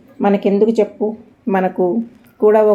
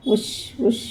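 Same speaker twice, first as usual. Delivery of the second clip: softer shushing; 3 semitones higher, 6 dB quieter than usual. A narrator's reading. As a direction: hush him urgently, barely audible. Wife hushes husband again.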